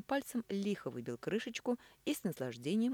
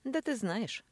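mocking; 8 semitones higher, 4 dB louder, slower than usual. A narrator's reading. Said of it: "насмешливо сказал Мануков".

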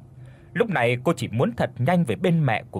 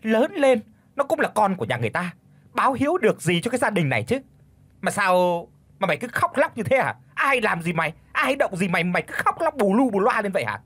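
angry scolding; 7 semitones higher than usual. Acted. Mother-in-law scolding daughter-in-law.

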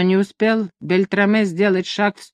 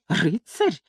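softer, contemptuous echo of Рыцарь; scornful snort; 5 dB quieter than usual. A narrator's reading. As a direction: sneer the word Рыцарь as a question. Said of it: "фыркнул Гонзага".

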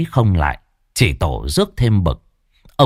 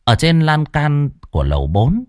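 ordinary delivery

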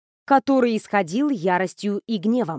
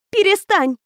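snapping ghost bride; 10 semitones higher, 5 dB louder than usual. Acted. Ghost bursts out.

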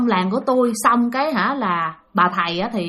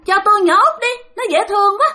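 high, insistent spirit wheedling; creepy high-pitched coaxing; covetous ghost; 10 semitones higher, 3 dB louder than usual. Acted. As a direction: beg for the child in a high ghostly voice. Ghost demands the youngest child.

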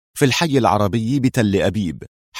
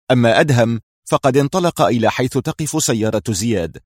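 ordinary delivery

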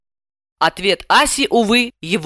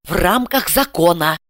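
ordinary delivery, nothing marked

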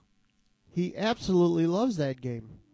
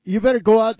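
teasing shout; 5 semitones higher, 9 dB louder, faster than usual. Real, not acted. Teasing friend about car windows.

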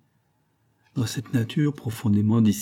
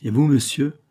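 higher and louder, menacing challenge; 5 semitones higher, 4 dB louder than usual. Acted. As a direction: threaten him with the question. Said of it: "d'un ton menaçant". (ton menaçant, plus haut et plus fort)

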